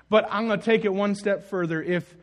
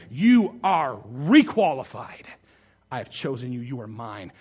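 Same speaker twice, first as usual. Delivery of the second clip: tender reassurance; 6 semitones lower than usual. Acted. Jesus speaks to believer.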